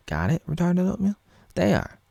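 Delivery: sing-song a childish mock taunt. Vlogger mimicking Flopper's taunt, squeaky and high.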